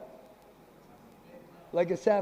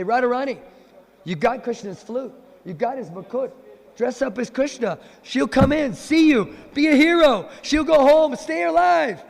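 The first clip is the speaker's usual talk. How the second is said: rising, ever louder high excitement; eager scheming; 7 semitones higher, 6 dB louder than usual. Acted. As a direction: sell the plan faster and brighter. Friends eagerly pitch a plan.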